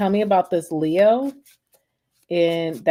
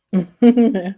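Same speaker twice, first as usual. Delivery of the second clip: short laugh; 3 semitones higher, 6 dB louder than usual. Real, not acted. Laughs on returning to stream.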